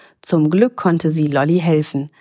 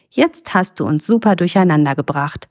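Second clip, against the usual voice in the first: ordinary delivery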